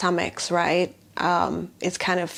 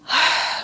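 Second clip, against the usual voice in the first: long, audible breath out; deep sigh. Real, not acted. Healer breathing out during a grounding exercise, deep sigh. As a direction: let out a slow sigh.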